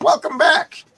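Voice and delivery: high, loud villain's greeting; mock booming welcome. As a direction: hail them loudly as a comic-book villain. Acted villain's loud greeting in comic.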